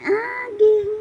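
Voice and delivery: high cooing call of the name; baby talk. Acted. Mother coos baby's name. (malambing na tawag sa sanggol, mataas ang boses)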